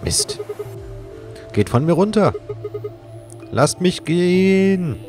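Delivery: high, loud anguished cries; tormented soul. Acted. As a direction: writhe and plead in anguish. Struggling under captors.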